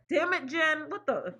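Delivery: high, emotional exclamation; tearful mock blame. Real, not acted. Crying host blames beloved manager, tearful mock blame. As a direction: curse fondly through tears. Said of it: "don't know why I'm crying".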